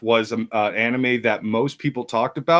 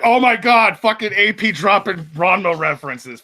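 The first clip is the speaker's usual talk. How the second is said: excited gush; 8 semitones higher, 4 dB louder than usual. Retold or imitated. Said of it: "I'm like".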